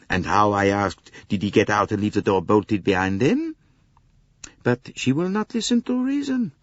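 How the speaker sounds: high, exasperated French conductor; a harried Frenchman pouring out his troubles. Acted. Conductor complains to Poirot about the American lady, exasperated.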